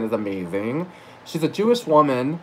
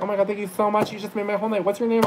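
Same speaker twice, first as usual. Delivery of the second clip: high, softer gushing imitation; Sarah Silverman; 7 semitones higher, 4 dB quieter, faster than usual. Silverman thanks the server for praise, high soft gushing imitation. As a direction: imitate her gratitude, pitched up and lighter. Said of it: "And she was like".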